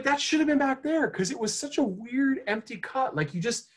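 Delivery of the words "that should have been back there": exasperated. Retold or imitated. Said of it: "Because I'm like".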